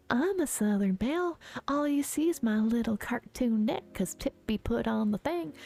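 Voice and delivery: close-mic Southern belle voice; hammed-up Southern belle for ASMR. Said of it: "I can't do Southern Belle A S M R".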